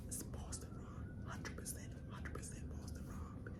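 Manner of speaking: whispered urgent tip-off; secret whisper. Retold or imitated. Derek quietly tipping off Dylan, whispered.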